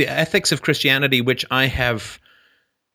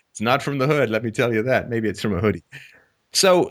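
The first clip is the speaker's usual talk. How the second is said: ordinary delivery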